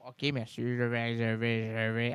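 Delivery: put-on low, timid mumbling old man; a low, frightened old man voice; meek old man. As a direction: cower before the officer. Meek character cowering before police.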